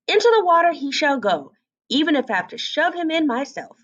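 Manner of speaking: a touch of huffy resolve; lightly voiced mother duck, set on testing him; determined mother duck. A narrator's reading. Mother duck sees big duckling.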